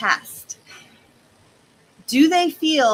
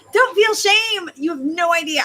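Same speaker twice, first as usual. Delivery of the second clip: emphatic plea; 6 semitones higher, 5 dB louder than usual. Real, not acted. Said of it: "urging listeners".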